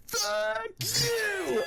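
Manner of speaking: sudden high-pitched "fuck you" at the dead worm; Kevin Bacon's outburst. Retold or imitated. Retelling a favourite movie moment, a high mock yelp. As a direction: yelp it out high, like a startled hero. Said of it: "Kevin Bacon just looks at it and goes".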